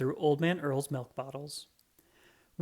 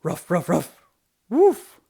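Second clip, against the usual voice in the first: excited dog barking; 2 semitones higher, 10 dB louder, slower than usual. Acted. Roy barks in reply to Pam Pam's kitty call, loud. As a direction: bark like a startled dog.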